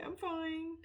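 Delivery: high, tight teary voice, forcing it out; teary brave voice. Retold or imitated. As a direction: pretend you're fine while about to cry. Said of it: "And I'm like".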